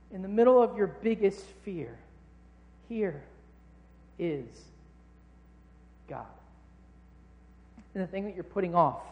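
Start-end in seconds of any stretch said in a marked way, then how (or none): 2.9-6.3 "here" "is" "God" quiet, separated by pauses; hushed declaration; soft spaced refrain. Shown as a demonstration. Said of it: "repeated refrain".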